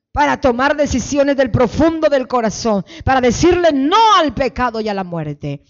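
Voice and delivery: loud preaching declaration, phrase by phrase; fervent led prayer. Real, not acted. Pastor leading the congregation in prayer, fervent declaration. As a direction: proclaim it with force for a crowd to repeat.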